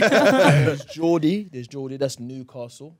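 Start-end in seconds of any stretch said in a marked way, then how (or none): 0.0-1.4 slow, high, caught in a laugh. Real, not acted. Guest lists UK accents, laughing at the phlegm joke. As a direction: still giggling as you name it.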